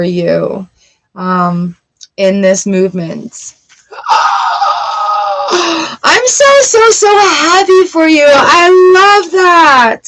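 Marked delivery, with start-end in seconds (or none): 4.0-5.4 sharp high gasp of delight; excited gasp. Real, not acted. Excited reaction to reading. 5.5-10.0 high, loud, happy squeal; excited. Real, not acted. Overjoyed for the viewer.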